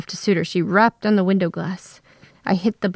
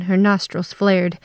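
ordinary delivery

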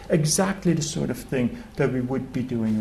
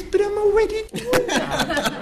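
much higher, laughing through the line; laughing punchline. Retold or imitated. Fisherman telling the tourist he already relaxes, laughing. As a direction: deliver the punchline cracking up.

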